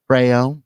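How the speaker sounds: "Brayone", imitating a Southern Brown; two-syllable Southern "Brayone"; Southern vowels. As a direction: split Brown into "Bray-one". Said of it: "And she said".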